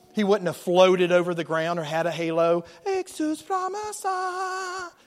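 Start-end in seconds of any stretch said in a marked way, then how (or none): 2.9-4.9 much higher, slow mock chant of a movie Jesus; mock holy voice. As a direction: intone it like a pompous film saviour. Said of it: "He wouldn't have talked with the English accent".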